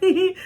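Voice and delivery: high, playful little hee hee; giggle. Retold or imitated. The mom giggling at her whenever they met, playful giggle. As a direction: giggle it back sweetly. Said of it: "she was like".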